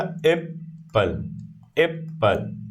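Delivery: "एप्पल" slowly broken as एप, पल; cut into parts. Shown as a demonstration. Teacher recalls the example, "एप्पल" cut into two parts. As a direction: leave a clear gap inside "एप्पल". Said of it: "जो part part cut कर रहे हो".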